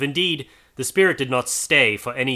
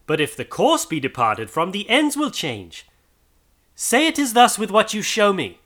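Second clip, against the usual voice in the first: rising to a high, louder plea; pleading; 9 semitones higher, 3 dB louder than usual. A narrator's reading. Scrooge begging the ghost to agree, rising plea. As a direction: build from reasoning to begging.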